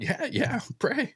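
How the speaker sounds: high, choked-up yes yes, pray; replaying his own crying, voice jumping way up; retold tearful yes. To the doctor offering prayer, high tearful consent. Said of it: "I'm crying at the time".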